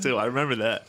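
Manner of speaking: ordinary delivery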